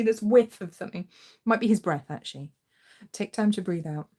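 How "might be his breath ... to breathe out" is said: usual speaking voice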